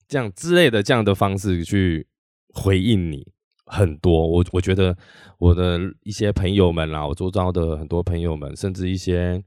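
usual speaking voice

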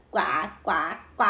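duck quacks. A narrator's reading.

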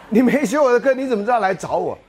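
loud laughing astonishment; laughing disbelief. Real, not acted. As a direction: exclaim in laughing surprise.

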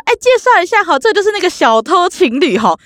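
high, sing-song sarcastic announcement; introducing the neighbours to her friends, high and mocking; mocking introduction. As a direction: show them off to friends with a sneer.

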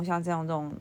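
dropping into a low, soft, lazy voice; relaxed low voice. Shown as a demonstration. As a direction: let the voice sink, no effort. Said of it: "如果我真的是非常放松". (放松低沉、懒懒的声音)

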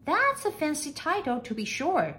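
high-pitched maid's voice; high, impressed maid; marvelling maid. A narrator's reading.

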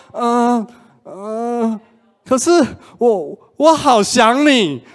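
hesitate, then plead you miss him; hesitant pleading longing. Retold or imitated. Girlfriend pleading on phone. (犹豫后高声慢慢哀求)

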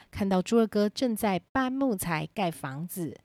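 ordinary delivery, nothing marked

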